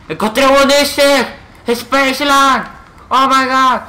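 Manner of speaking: very high, loud squeal of discovery; ecstatic shouting. Real, not acted. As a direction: shout your find in wild excitement. Found an Easter egg.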